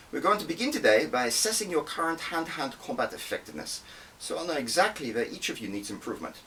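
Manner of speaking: brisk drill-instructor briefing; Drea briefing recruits. Acted. Instructor Drea briefs new recruits, brisk and commanding.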